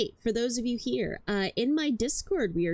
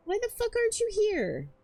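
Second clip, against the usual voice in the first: high exasperated complaint; 13 semitones higher than usual. Real, not acted. Exasperated at absent friend.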